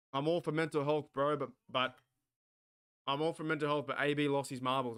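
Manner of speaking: normal talking voice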